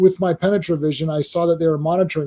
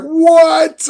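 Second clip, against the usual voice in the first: shocked what; 11 semitones higher, 6 dB louder than usual. Retold or imitated. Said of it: "I was like".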